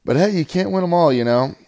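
cheerful can't-win-'em-all; upbeat shrug. Real, not acted. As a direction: bounce back with a cheery shrug. Sudden upbeat shift.

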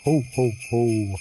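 slow, loud Santa laugh; jolly ho ho ho. Acted. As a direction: belt out a big Santa ho ho ho. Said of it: "That was really good".

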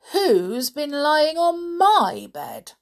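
high, loud, slow indignant mama bear; mother bear. A narrator's reading. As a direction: lift it high and stretch the question. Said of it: "said the mother bear".

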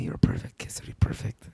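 imitated lover's whisper, barely any voice; intimate whisper. Woman imitating her date praising her kissing, intimate whisper. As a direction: whisper it like a secret compliment. Quoting the date's intimate compliment.

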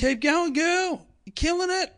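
mock cheering. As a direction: shout encouragement from a passing car. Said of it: "my mate beeps and goes".

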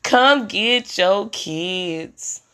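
slow pleading call. Retold or imitated. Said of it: "I'm calling daddies".

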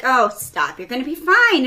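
loud, high brush-off; emphatic mock scolding comfort; breezy reassurance. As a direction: imitate someone waving away a worry. Nurse brushes off fear, acted.

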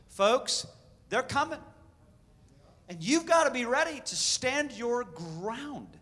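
loud, urgent rallying cry; roaring rallying speech. Real, not acted. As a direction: rouse the crowd to stand. Rallying the congregation.

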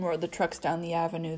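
usual speaking voice

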